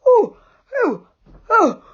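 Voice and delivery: high, loud hammed-up sleepy fluster. A narrator's reading. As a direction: pretend to wake with a start. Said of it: "in a sleepy voice".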